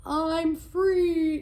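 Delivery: lion yelling with joy; joyful yell. Acted. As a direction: burst out with relief. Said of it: "yelled the lion".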